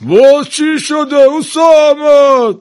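loud, very high, drawn-out shout; exasperated call. A narrator's reading. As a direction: call out, fed up with the worker. (فریاد کلافه و کشیده)

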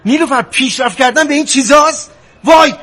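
loud disbelief, climbing to a sharp exclamation; exasperated outburst. Acted. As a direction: burst out in exasperation at your wife. Husband rebukes wife in money quarrel.

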